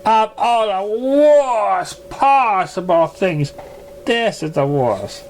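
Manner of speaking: high, very slow theatrical lament; dramatic swoon. Acted. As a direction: swoon over the tragedy like a diva. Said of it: "fighting dramatically with a swoon".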